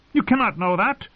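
high-pitched, insistent protest; insist, pitch shooting up; heated contradiction. A narrator's reading. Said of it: "Edmure insisted".

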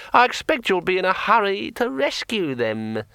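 talking sword. A narrator's reading. Sword Kring speaks.

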